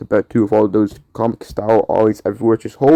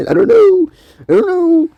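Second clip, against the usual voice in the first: much higher, louder squeal; squeaky I don't know; 6 dB louder than usual. Real, not acted. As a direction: squeak it out flustered.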